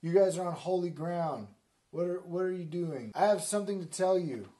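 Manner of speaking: hammed-up holy command; commanding bush. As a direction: halt them with authority. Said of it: "And the bush was like".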